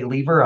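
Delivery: "lever" said LEE-ver, long ee; long-e pronunciation. Shown as a demonstration. Guest quoting how the team says it, "lever" as LEE-ver. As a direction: say "lever" to rhyme with beaver. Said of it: "when they say lever".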